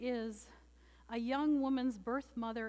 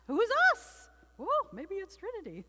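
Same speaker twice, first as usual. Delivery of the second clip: much higher, louder puzzled question; mock bewildered; 8 semitones higher, 4 dB louder than usual. Shown as a demonstration. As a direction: ask it again in playful disbelief. Rhetorical question repeated, pitched up.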